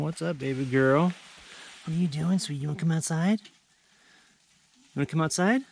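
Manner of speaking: talking to the dog. Real, not acted. Coaxing the dog outside.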